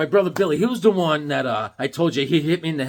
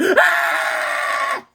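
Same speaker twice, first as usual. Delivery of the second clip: piercing high cry of dismay; high shocked scream; 4 dB louder than usual. Retold or imitated. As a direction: shriek like a kid whose toy got smashed. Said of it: "I went".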